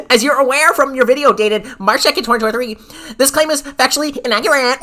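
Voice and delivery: hammed-up formal letter read; prim email voice. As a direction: read it like a pompous lawyer. Reading the email in put-on voice.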